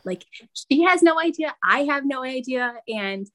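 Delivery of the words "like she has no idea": "no" punched high and loud; stress on no. Real, not acted. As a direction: hit "no" hard and high. Emphatic aside.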